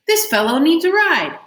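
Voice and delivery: put-on high, loud young boy voice; the magic boy calling to the stone for a ride, high and loud; boy's voice. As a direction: call it like an eager boy.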